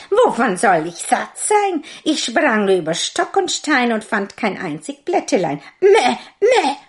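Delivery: high, loud bleating goat voice; spiteful goat. A narrator's reading. Spiteful goat lying to the father in a high bleat. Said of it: "das boshafte Tier".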